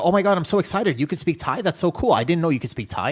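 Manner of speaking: high, loud gushing, a bit fast; imitating excited fast talker. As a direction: mimic an overexcited local gushing. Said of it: "speaking Thai like this".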